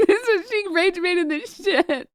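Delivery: high-pitched words breaking into laughter; laughing hard. Real, not acted. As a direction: talk right through a laughing fit. Cracking up while praising rage bait.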